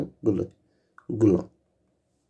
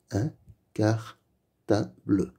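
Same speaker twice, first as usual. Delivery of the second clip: Un cartable slowly split into un, car, ta, ble; slow, separated reading of "Un cartable", final e voiced; syllable by syllable; slower than usual. Shown as a demonstration. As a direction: sound out "Un cartable" with gaps between syllables. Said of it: "Lis les mots".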